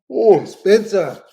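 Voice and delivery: yawning through the words. Real, not acted. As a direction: say it mid-yawn. Yawning mid-stream.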